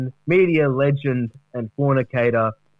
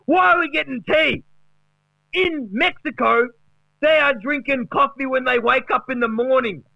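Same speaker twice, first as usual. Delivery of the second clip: much higher, hyped rant; mock Wayne Carey yell; 9 semitones higher than usual. Carey ranting at Gary about coffee, much higher and hyped. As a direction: yell it like a manic footy legend. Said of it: "just starts yelling".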